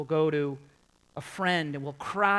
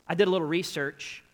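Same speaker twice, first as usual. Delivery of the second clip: ordinary talk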